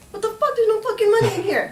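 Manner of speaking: high, loud mock outrage; outraged robber. Mugger finding an empty wallet, imitated high-pitched outrage. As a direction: imitate a mugger squawking in disbelief.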